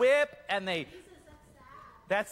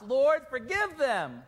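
Jesus crying out; 5 semitones higher, 4 dB louder, slower than usual. Acted. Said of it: "And Jesus cries from the cross".

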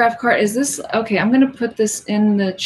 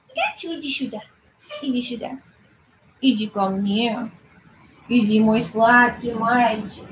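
calling the cat in Russian. Real, not acted. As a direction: murmur sweetly to lure the cat over.

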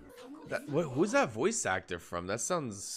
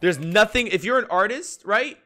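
higher, louder ranting push; higher and louder riled-up rant; heated rant; 10 semitones higher, 7 dB louder than usual. Real, not acted. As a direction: press the point, worked up. Ranting about craft stores.

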